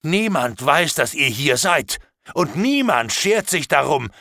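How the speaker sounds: angry threat. Acted. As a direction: rage at the captives and scare them. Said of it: "da wurde ich noch wütender als vorher". (lauter, wütender, drohender Ausbruch)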